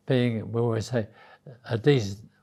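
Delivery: ordinary delivery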